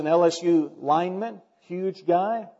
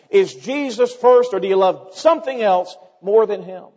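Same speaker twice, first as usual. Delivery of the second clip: loud, raised, driving sermon delivery; full-force preaching; 5 semitones higher, 6 dB louder than usual. Real, not acted. Preacher pressing the congregation on who is first, full force. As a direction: drive each question home, high and loud.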